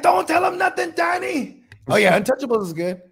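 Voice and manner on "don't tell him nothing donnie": urgent plea. Retold or imitated. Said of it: "And he's like".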